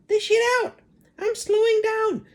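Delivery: put-on very high, loud cackling old woman; screechy crone. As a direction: cry out like a greedy hag.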